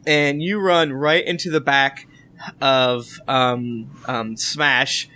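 normal talking voice

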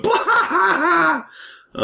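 high, slow mock guffaw; loud guffaw. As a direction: imitate a big belly laugh. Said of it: "I remember myself guffawing".

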